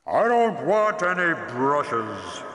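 slow, loud, high booming roar; roaring dragon. Acted. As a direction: boom it out like a dragon. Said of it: "the voice roared".

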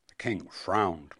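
ordinary delivery

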